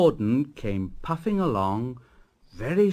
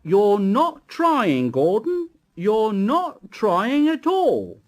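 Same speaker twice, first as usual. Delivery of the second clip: slow, loud, higher stern scold; severe scolding; 9 semitones higher, 6 dB louder, slower than usual. Acted. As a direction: scold the engine firmly. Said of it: "talking to him severely".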